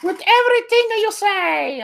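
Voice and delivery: put-on very high, drawn-out Italian-accented falsetto; hammed-up Italian accent in a sustained high pitch; Gabriella, Italian accent. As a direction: put on a silly squeaky Italian woman. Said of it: "I don't know why she's Italian".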